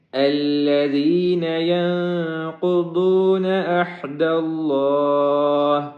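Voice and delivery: slow melodic Quran chant; chanted Quranic recitation, long and melodic; tajweed recitation. Shown as a demonstration. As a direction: draw each word out in sung recitation. Recitation of the verse.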